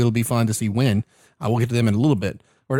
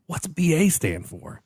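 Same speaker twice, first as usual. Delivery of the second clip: usual speaking voice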